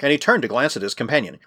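usual speaking voice

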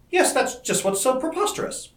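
high, exclaiming woman's voice; lightly voiced woman, exasperated and high; protesting woman. A narrator's reading. Dorothy protests at the wild story.

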